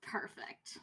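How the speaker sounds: whisper. Real, not acted. Player reacts to outfit.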